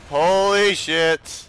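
shocked. Real, not acted. Sudden explosion near boat.

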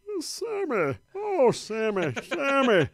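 [name]. Retold or imitated. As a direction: belt it like an old vaudeville crooner. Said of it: "throw a little [name] into it".

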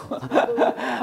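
wheezy giggle at own joke; breathy amused laugh. Real, not acted. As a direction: crack up softly after a mock prayer. Laughs after his mock prayer. (농담 뒤에 숨차게 터진 웃음)

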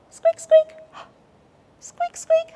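high, tiny mouse squeaks. A narrator's reading. Tiny mouse noise heard.

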